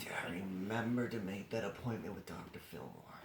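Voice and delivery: low, soft muttered musing; gorilla's inner voice. Acted.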